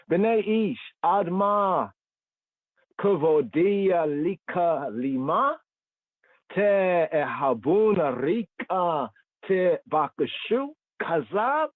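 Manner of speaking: high, slow reading of the Hebrew verse; chant-like lifted reading of the Hebrew words; Hebrew scripture reading. Acted. Teacher reading the verse in Hebrew to his class, high slow reading.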